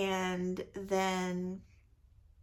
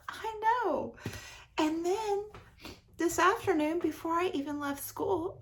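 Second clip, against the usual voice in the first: higher, bubbling with pride; excited brag; 7 semitones higher, 4 dB louder than usual. Real, not acted. Bragging to viewers about prize books, giddy and high. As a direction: gush about the reading streak like it's a miracle.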